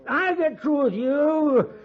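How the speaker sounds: high, slow mock menace; drunken threat. Drunk Nebraska threatening Kendall over the bottle. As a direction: threaten him, half joking.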